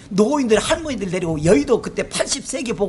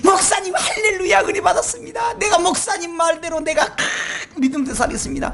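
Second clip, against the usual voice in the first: mock fervent member; 6 semitones higher than usual. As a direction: gush with zeal as the congregant. Imitating excited congregant. (높고 들뜬 성도 흉내)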